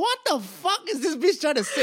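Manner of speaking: lightly voiced baffled audience member; mock baffled heckle. Comic at the back of the room, mildly mock baffled. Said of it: "I was like".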